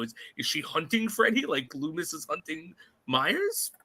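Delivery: ordinary talk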